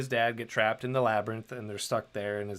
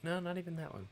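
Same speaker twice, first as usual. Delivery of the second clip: high, softer trailing denial; mock protesting; 7 semitones higher, 7 dB quieter, faster than usual. Host correcting an imagined listener, mock protesting and softer. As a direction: wave off the last wrong guess, high and softer.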